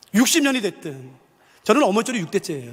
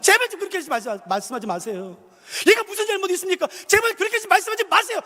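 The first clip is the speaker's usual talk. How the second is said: rising to a high, pleading protest; indignant plea; 10 semitones higher than usual. Retold or imitated. Child pleading with village elder to stop insulting friend. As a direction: stand up to the elder, begging him to stop. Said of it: "벌떡해서 어른한테 말했어요".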